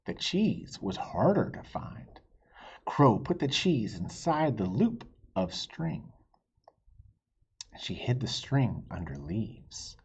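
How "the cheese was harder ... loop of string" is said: plain reading voice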